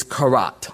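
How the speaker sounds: karat said like carrot, stress on the second syllable; Hebrew pronunciation. Shown as a demonstration. Preacher teaching a Hebrew word, karat stressed on its end. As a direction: say karat as kuh-ROT. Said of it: "Sounds like carrot".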